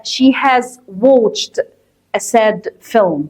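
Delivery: slow, deliberate reading of the answer; slow confirming teacher voice. Shown as a demonstration. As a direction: give the answer slowly for the class. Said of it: "طب ليه هي بتعيط؟".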